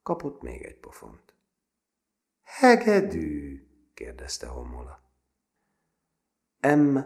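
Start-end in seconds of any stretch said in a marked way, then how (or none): 2.4-3.7 high, loud, drawn-out question; slow raised jeer; menacing taunt. A narrator's reading. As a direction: stretch the word slowly to mock the victim. Bully taunts the beaten boy.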